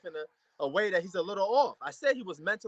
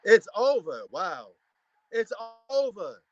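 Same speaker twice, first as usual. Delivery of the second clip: ordinary delivery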